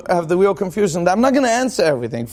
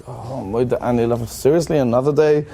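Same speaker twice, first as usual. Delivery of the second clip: weary mumbled prayer; 9 semitones lower than usual. Retold or imitated. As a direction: drag through it like another chore. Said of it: "Or like".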